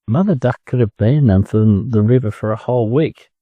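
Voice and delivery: plain reading voice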